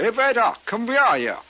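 put-on high, loud German-accented call; mock German accent. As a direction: summon the waiter in fake German. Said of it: "everything I say is in a German accent".

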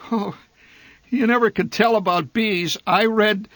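ordinary delivery, nothing marked

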